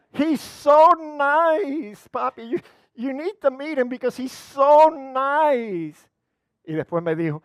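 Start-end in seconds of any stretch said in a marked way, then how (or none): 0.1-6.1 high, swooning voice stretching "so nice"; gushing daughter. Retold or imitated. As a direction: gush like a girl in love. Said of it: "me lo dijo". (hija enamorada, voz aguda y melosa)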